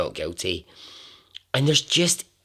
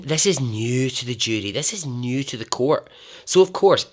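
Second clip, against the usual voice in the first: usual speaking voice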